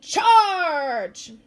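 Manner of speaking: loud, high battle cry; commanding shout. A narrator's reading. Princess doll orders the giant to attack, loud commanding shout.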